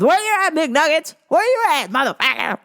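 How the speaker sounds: high, loud mock catchphrase; mocking impression. Mimicking a fighter opening his mouth, high mocking holler. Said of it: "when he opens his mouth".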